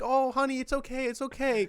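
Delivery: high, soothing mother's voice; calm him down like a mom. Retold or imitated.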